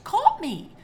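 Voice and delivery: ordinary delivery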